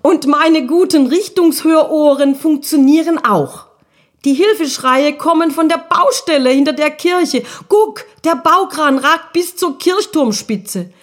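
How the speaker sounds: high, eager little ghost voice; a small spirit proud of his ears; excited little ghost. Acted. Guschuk voiced by narrator.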